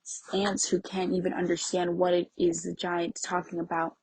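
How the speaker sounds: ordinary talk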